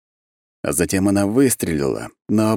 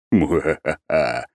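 slow low mock villain laugh; evil laugh; 3 semitones lower, much slower than usual. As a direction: gloat like a cartoon villain. (злодейский смех)

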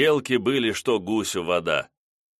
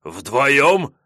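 louder, higher furious retort; enraged; 5 semitones higher, 6 dB louder than usual. A narrator's reading. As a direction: explode with indignation. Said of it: "взбеленился Блесс".

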